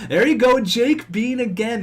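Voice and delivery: sudden high, loud cheer; excited goal call. Real, not acted. Excited goal call in hockey game.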